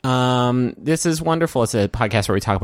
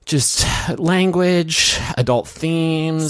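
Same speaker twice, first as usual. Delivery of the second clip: drawn out; 8 semitones higher, much slower than usual. Pretend. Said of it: "get ready for just a lot of loud".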